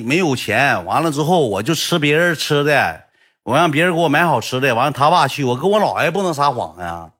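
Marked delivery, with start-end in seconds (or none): none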